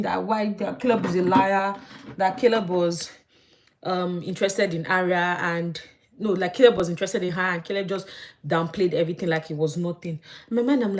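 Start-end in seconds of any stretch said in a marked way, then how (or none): none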